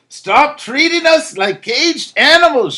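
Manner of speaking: high, slow, desperate shriek; shrieking crowd. A narrator's reading. The people shrieking at their captors. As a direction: cry out against being caged. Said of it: "shrieks the people".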